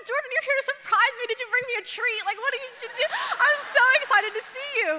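high-pitched, hammed-up excited gush; gushing delight. Woman thinking her fiance came to surprise her, gushing delight. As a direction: gush high and eager, building to a quick finish. Delighted greeting at the door, retold.

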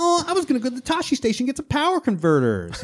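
put-on high, fast whining kid voice; hammed-up whiny teenager; whiny Luke. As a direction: whine it like a sulky farm boy. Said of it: "I love how whiny Luke is".

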